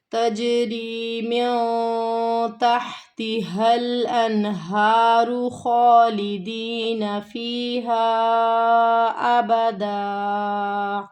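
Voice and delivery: slow, drawn-out melodic tajweed recitation; Quran recitation. Shown as a demonstration. Teacher recites the verse for her students, slow and melodic. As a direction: chant each word long and held.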